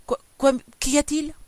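high, loud, stuttered questions; flustered, alarmed husband; startled stammer. A narrator's reading. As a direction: stammer as if jolted awake. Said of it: "Il balbutia".

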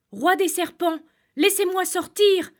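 high, loud, desperate cry; shouting with all his strength. Acted. Shepherd trapped in the cave shouts to the serpent king. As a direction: yell it out as loud as you can, begging. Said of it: "cria le berger de toutes ses forces".

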